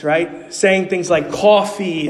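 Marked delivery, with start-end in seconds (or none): 1.3-2.1 imitated New York accent; light mimicry of New York speech. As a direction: say it like a New Yorker. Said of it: "New Yorkers are known for their accents".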